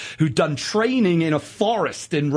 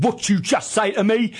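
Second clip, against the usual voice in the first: a bit higher, mock-tough English hooligan accent; 7 semitones higher than usual. Telling a drunk fan at the match off in a mock-hard English hooligan accent. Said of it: "I put on my best hooligan accent".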